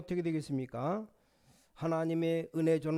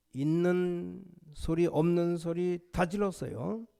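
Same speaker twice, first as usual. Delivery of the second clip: ordinary talk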